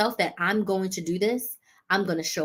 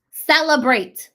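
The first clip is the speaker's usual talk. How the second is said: one word called out louder; loud announcement; 4 semitones higher, 8 dB louder, slower than usual. Acted. Host announcing tip five, loud proclamation. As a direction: proclaim it like a headline.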